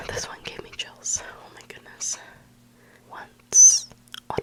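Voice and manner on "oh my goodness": usual speaking voice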